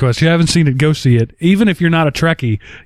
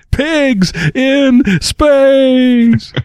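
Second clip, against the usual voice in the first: mock announcer; 8 semitones higher, much slower than usual. Echoing Muppets announcer joke.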